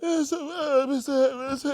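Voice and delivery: high, hesitant mock stammer; flustered reply. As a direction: stumble over the words like a flustered member. Imitating flustered church member.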